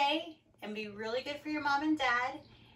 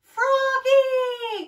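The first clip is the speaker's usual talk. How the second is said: high, drawn-out holler; mother calling loudly from afar; 13 semitones higher, 14 dB louder than usual. A narrator's reading. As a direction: sing out the name high and long. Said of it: "called his mother".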